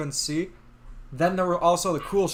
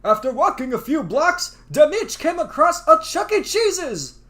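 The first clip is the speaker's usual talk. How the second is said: narrator voice; 9 semitones higher, 5 dB louder than usual. Acted. Reads game narration.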